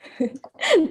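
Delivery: brief high-pitched laugh; short laugh. Real, not acted. Translator handed a long speech to translate, short laugh.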